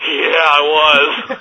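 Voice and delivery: very drawn-out, slow yeah; dazed agreement. Retold or imitated. Embarrassed fan answering a crew member, dazed agreement. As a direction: stretch the reply out in a mock daze. Dazed reply to the production assistant.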